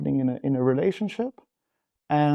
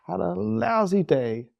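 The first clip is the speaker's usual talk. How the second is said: higher, slowed, stretching lousy with self-pity; lousy drawn out, pitched up like a martyr; mock indignant whine; 7 semitones higher, slower than usual. Husband voicing his resentment toward his wife, a slowed, higher mock whine. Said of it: "what comes up for me is".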